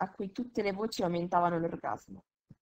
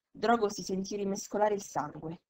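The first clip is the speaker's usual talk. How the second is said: ordinary delivery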